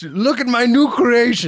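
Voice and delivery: high, loud showman voice; proud inventor. Pretend. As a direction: present it like a proud mad inventor. Mock inventor presenting robot.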